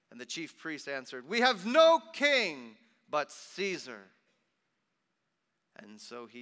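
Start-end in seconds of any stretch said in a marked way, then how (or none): 1.3-4.1 loud, slow, raised voice; emphatic declaration. A narrator's reading. Chief priests answering Pilate, loud slow declaration. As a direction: declare it forcefully for all to hear.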